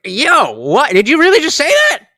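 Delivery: loud, stunned "did you really" reaction, rushing; shocked disbelief. Retold or imitated. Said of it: "to me, it is funny because it's like".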